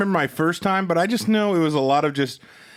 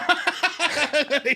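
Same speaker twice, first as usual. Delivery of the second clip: high burst of laughter; 11 semitones higher than usual. Real, not acted. Loud laugh.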